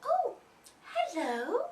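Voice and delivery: high surprised "oh", then hello; startled greeting. Acted. Goldilocks wakes to three bears, surprised high greeting. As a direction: wake up caught unawares.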